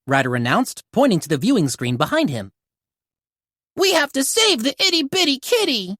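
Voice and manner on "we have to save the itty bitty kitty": high, eager pup voice; excited exclaim. Acted. Rubble exclaiming to the pups about the kitten. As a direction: gush about the tiny kitty. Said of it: "exclaimed Rubble".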